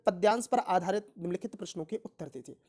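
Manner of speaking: usual speaking voice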